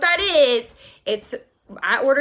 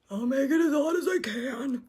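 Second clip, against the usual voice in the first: a lightly voiced, softer, bored drive-thru worker; mock a cashier with no personality; sulky cashier who couldn't care less; imitating grumpy cashier; 6 dB quieter than usual. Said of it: "That's how I pictured her saying it".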